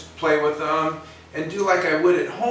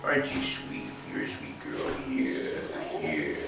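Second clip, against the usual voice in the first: soft, breathy whispered sweet talk; whispering sweetly to a scared puppy; soothing whisper to dog; 7 dB quieter than usual. Shown as a demonstration. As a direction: coo gently to calm a nervous dog. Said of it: "just go".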